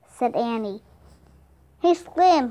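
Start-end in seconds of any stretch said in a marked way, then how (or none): 1.8-2.5 higher, louder call for attention; raised voice, hailing someone. A narrator's reading. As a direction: call over to a friend. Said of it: "he called".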